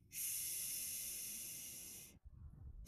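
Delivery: a long, voiceless sh; hissed sh sound. Shown as a demonstration. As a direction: push out a steady sh. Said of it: "So next we have the sound".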